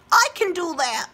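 high, eager little tadpole. Acted. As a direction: pipe it up like a small, keen tadpole. Tadpole speaks.

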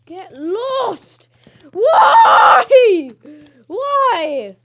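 very high, loud wailing cry; anguished shout. Real, not acted. Gamer being killed, wailing cries. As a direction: cry out in despair. Attacked in game, crying out.

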